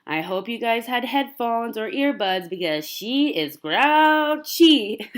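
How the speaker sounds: hammed-up loud warning to fellow passengers. Mom warning passengers about her baby, slow and loud. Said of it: "I told people".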